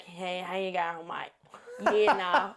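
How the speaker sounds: slow, drawn-out Aussie bogan greeting; rough attempt at a broad Australian accent. Imitating Aussie bogans for a friend, Aussie bogan accent. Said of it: "if you think about an Aussie bogan".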